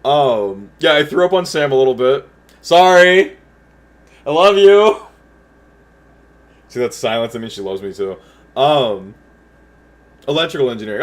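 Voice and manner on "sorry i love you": loud, higher call across the house. Real, not acted. Calling to Sam after admitting the throw-up, loudly. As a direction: project it to someone out of sight.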